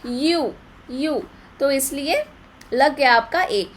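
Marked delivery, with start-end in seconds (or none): 0.1-1.2 slow, drawn-out यू with a y onset; slow y sound demo. Shown as a demonstration. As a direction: stretch यू to show the y. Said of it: "यूनिट".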